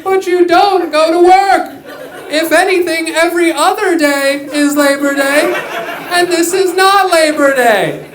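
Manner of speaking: high, loud, laughing political speech voice; Kamala Harris impression. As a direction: ham up a cackling candidate at a podium. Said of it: "That was my impression of Kamala Harris".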